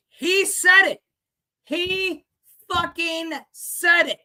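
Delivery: insistent. Real, not acted. Seller insisting to viewers Trump truly said it, excited. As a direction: swear he really said it.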